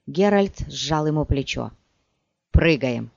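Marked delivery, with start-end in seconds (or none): 2.5-3.1 low, restrained command; contained order. A narrator's reading. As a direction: give the order low, without shouting. Order repeated after comforting companion.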